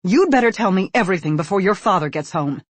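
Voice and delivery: a mother's stern, loud warning. Acted. Mother warns her son before dad gets home, stern and loud. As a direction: threaten him before his father returns.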